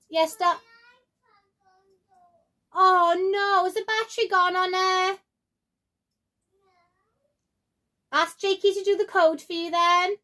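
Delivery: loud, high parent call to another room. Real, not acted. Calling out to kids in another room.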